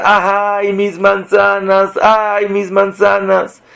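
high, drawn-out wail over lost apples; mock lament of a robbed woman, slow and high; moaning ay over the fallen fruit; crying out. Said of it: "empezó a gritar".

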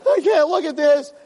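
high mock disgusted wife; wife recoiling. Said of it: "and she's like".

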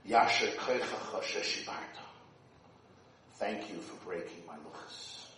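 soft, low whispered words of God; hushed reverent. Acted. Rabbi voicing Hashem thanking Moshe, hushed. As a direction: let it fall to a whisper.

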